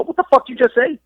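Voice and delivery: retold challenge, pitched well up; incredulous demand. Wrestler retelling how he challenged his winded opponent, incredulous. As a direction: throw the question out in high disbelief.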